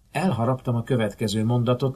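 ordinary delivery

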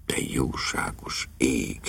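low, soft, whispery dismay; horrified groan. A narrator's reading. Lord daunted by the difficult call, groaning in horror. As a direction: groan it under the breath, aghast. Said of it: "nyögte Lord Emsworth rémülten".

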